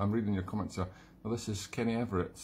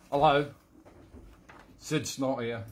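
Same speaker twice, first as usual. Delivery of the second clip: lightly voiced London yob comic character; a hint of a comic London rogue; Sid Snot; 2 semitones higher, 3 dB louder, slower than usual. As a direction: announce yourself in a mild Cockney character voice. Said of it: "Sid Snot quote coming up".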